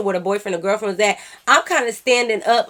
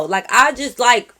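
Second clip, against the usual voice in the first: usual speaking voice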